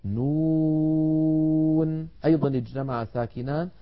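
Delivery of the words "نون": نون stretched very long; held letter name. Shown as a demonstration. Teacher chants the letter name, very slowly. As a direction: intone نون for a long time. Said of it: "مع النون بعدها".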